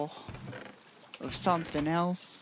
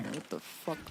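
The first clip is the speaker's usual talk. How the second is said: quiet bafflement; 4 semitones lower, 9 dB quieter than usual. Real, not acted. Puzzled at finding soldering in there.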